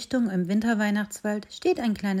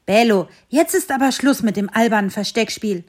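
louder, cross scolding; fed-up owner ends the game, annoyed and louder; angry owner; 2 semitones higher, 7 dB louder than usual. Acted. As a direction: scold the hiding dog. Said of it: "Das Herrchen klang jetzt wütend".

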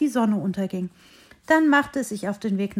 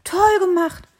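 high, loud, thrilled praise; delighted praise; 9 semitones higher, 6 dB louder, slower than usual. Acted. Little tree praising the hare's salto, high excited call. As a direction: cheer the friend on.

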